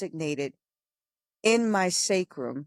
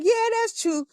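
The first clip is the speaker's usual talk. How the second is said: conceding sister; 4 dB louder than usual. Retold or imitated. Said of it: "She's like".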